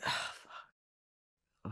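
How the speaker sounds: under-the-breath curse, barely voiced; retold private sigh of regret, very soft; muttered to self. Cursing to oneself before the date, under the breath. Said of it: "to myself, I was just saying".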